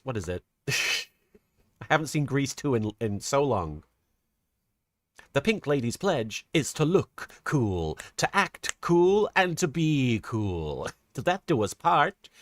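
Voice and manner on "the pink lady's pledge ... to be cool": slow, chanted club pledge; reciting the pledge. Retold or imitated. Streamer recalls Grease, slowly chanting the pink ladies pledge. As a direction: lightly recite it like a Pink Lady oath.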